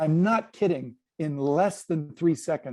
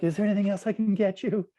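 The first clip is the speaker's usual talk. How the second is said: quick, higher-pitched fawning waiter; cringing servant; 5 semitones higher, faster than usual. Acted. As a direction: play an over-eager, cringing servant. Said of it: "like a cringing sort of servant".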